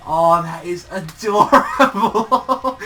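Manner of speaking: high, loud, drawn-out squeal; fangirl-style slow, loud swoon; gushing. Retold or imitated. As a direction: gush over unbearable cuteness, voice rising into a laugh. Gushing over the adorable face.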